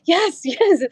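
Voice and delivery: high-pitched mock yell of triumph; triumphant yell. Alone in the truck after passing, retold elated yelling. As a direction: celebrate in a high voice, laughing. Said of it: "I was yelling".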